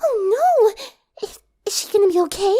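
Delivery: high, fast, tearful worry; frightened. A narrator's reading. Fluttershy hearing of a hospitalized friend, tearful panic. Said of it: "almost dropped her bottle".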